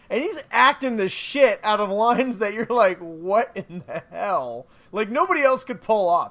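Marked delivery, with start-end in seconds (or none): 3.0-4.7 slow, drawn-out disbelief; mock bafflement. Voicing a viewer hearing hammy acting, slowly baffled. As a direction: stretch each word in stunned mock confusion.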